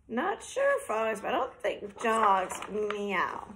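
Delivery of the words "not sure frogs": usual speaking voice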